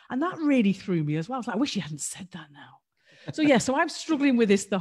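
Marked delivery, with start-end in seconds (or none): 1.6-2.8 a rueful whisper under the breath; whispered regret. Retold or imitated. Retold regret after wife's remark.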